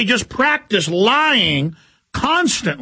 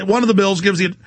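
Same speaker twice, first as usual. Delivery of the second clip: normal talking voice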